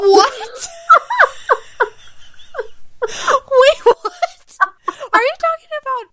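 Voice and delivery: high, loud shocked laughter; laughing disbelief. Real, not acted. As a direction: squeal through laughter.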